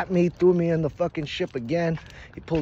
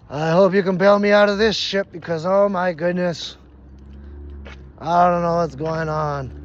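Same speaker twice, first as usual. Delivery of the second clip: calling out; 3 semitones higher, 7 dB louder than usual. Retold or imitated. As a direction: call up to the ancestors for rescue. Calls up to his ancestors.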